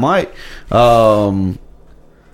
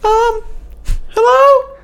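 very high, breathy prank caller voice; squeaky fake caller. Fake caller phoning the radio show, very high and nervous. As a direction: call in as a nervous squeaky customer.

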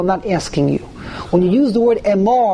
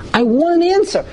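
plea; 9 semitones higher than usual. Acted. Repeated plea, higher.

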